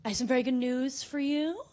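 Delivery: usual speaking voice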